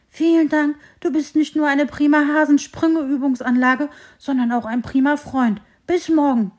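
high, loud, joyful thanks; happy thanks. Acted. Hare thanking the tree after a salto, loud joyful voice. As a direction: bubble over with gratitude.

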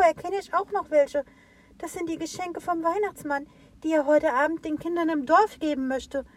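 put-on high, anxious childlike tree voice; worried little tree. A narrator's reading. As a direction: fret over the lost gifts. Little tree, worried about the gifts.

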